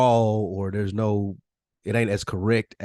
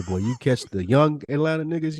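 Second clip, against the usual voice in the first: normal talking voice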